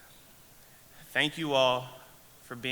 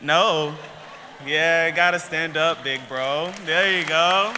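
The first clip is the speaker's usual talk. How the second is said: calling across the room, much louder; loud urging call; 6 semitones higher, 10 dB louder, slower than usual. Real, not acted. Honoree urging his big bro to stand, loud. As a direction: shout him up onto his feet, teasing.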